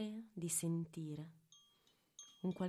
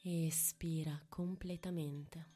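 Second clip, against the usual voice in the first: whispering; 4 dB quieter than usual. Acted. Whispered breathing cue.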